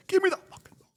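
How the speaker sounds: high, strained mock yell; Derrick Henry demanding. Fan retelling Derrick Henry's sideline plea as a high mock yell. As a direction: ham up a furious running back begging for the ball. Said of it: "I saw Derrick Henry say".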